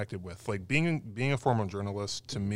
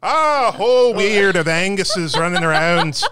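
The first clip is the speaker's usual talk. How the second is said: put-on Scottish accent; 7 semitones higher, 11 dB louder than usual. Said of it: "with my really bad Scottish accent".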